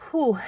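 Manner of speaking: a real puffing exhale, winded; breathless whew. Real, not acted. Vlogger catching her breath on arriving. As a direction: blow out a tired breath after rushing. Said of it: "I'm out of breath".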